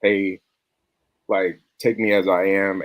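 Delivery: normal talking voice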